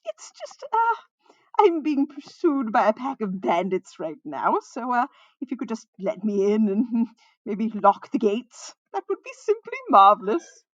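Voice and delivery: loud, drawn-out theatrical pleading; flamboyant minstrel. A narrator's reading.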